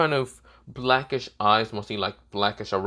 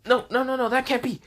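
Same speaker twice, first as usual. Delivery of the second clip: high, rapid-fire protest; panicked denial; 13 semitones higher than usual. Acted. She sees the pawn rejected from Issei's body, panicked. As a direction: act out rising disbelief in a high voice.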